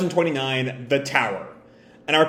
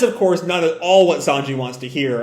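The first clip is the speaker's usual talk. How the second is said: normal talking voice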